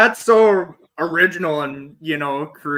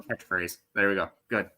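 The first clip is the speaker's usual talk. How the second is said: low, deep mock Bane growl; lightly voiced masked villain; a half-hearted Bane impression; 11 semitones lower, 9 dB quieter than usual. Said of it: "I'll do it in my Bane voice".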